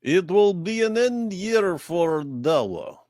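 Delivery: slow, high Russian accent. Shown as a demonstration. Co-host performing on request, Russian accent. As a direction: put on a drawn-out Russian accent. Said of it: "can you do the Russian accent?".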